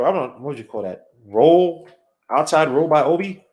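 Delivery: ordinary talk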